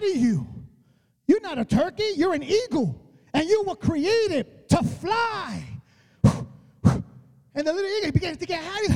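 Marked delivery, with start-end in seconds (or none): none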